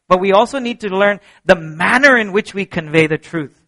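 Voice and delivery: normal talking voice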